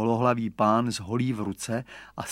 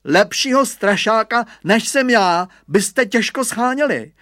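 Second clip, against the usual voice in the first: higher, louder swaggering brag; boasting; 9 semitones higher, 7 dB louder than usual. Acted. Boy bragging to the family about scaring birds, louder swagger. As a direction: puff yourself up and brag. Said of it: "naparoval se Hugo".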